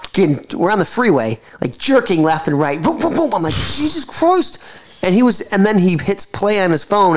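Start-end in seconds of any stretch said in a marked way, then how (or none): 2.8-3.3 high-pitched "boom" noises; imitated jolting car. Passenger retelling the car jerking, high imitated jolts. 3.5-4.6 high, drawn-out exclamation; mock alarm. Passenger reacting to a jerking car, high mock alarm.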